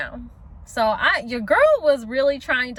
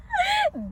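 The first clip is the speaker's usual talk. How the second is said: quick high laugh; real laugh; 3 dB quieter than usual. Real, not acted. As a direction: laugh before the punchline. Laughs before punchline.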